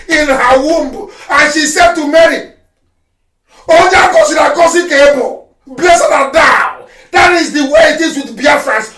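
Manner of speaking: loud, fervent preaching; fiery sermon voice. Acted. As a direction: proclaim it like a sermon. Preaching at full force.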